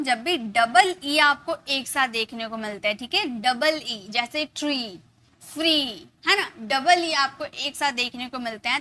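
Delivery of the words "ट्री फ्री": ट्री and फ्री with long ee endings; pronunciation model. Shown as a demonstration. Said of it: "ई का sound अब निकालेंगे". (ट्री, फ्री में लंबी ई)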